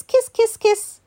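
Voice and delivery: high, quick pecks of kiss; high kissing noises. Acted. Reader acts out Dogman kissing Petty, high little pecks. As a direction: imitate a flurry of little kisses. Acting out kissing sounds in story.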